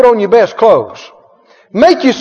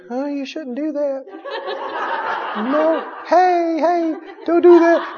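much softer, higher, feeble little voice; weak timid spirit; 5 semitones higher, 8 dB quieter, slower than usual. Acted. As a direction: play a scrawny spirit barely protesting, laughing. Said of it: "the spirit goes".

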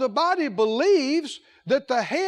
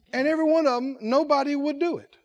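normal talking voice

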